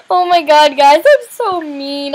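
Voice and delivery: ordinary delivery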